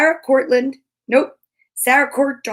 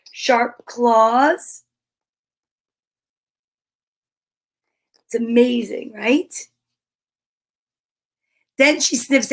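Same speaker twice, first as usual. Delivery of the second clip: put-on reading to children. Teacher reads the bear book aloud to young kids. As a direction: read it to little ones with wonder.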